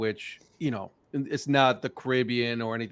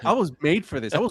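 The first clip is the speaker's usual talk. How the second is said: mock-eager, higher and louder; imitating eager friend; 4 semitones higher, 4 dB louder than usual. Host voicing the guest's thrill at teaching baseball, mock-excited. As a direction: play up a friend's big moment. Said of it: "Paul is like".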